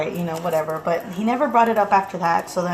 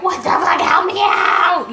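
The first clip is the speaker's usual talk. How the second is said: mock exasperated shout; 10 semitones higher, 6 dB louder, slower than usual. Wife shouting at husband.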